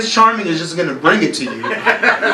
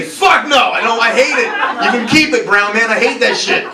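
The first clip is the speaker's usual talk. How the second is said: higher-pitched mock outrage; mock outburst; 5 semitones higher than usual. Comic voicing an audience member's hidden dislike of Indian accents, mock outburst. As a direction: blurt it out as pent-up disgust. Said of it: "tried your hardest to not say".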